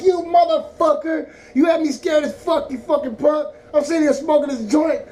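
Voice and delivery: high-pitched, loud outraged rant; going off. Retold or imitated. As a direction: chew out the caller who scared you, drawing out the first insult. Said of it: "I went off on his podcast".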